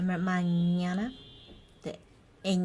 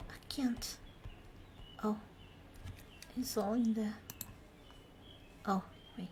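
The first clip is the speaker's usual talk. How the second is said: whisper; 2 semitones higher, 12 dB quieter, faster than usual. Real, not acted. Self-conscious aside.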